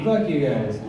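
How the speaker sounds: ordinary delivery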